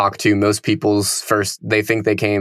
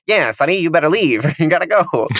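higher, hammed-up cop voice; tough cop voice; 7 semitones higher than usual. Mocking the cop telling kids to leave. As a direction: play the second officer as a cartoon tough guy. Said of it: "the other guy is like".

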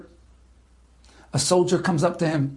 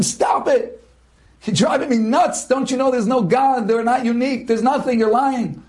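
angry retort; 6 semitones higher than usual. Retold or imitated. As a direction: shout him down in disbelief. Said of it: "I tell him".

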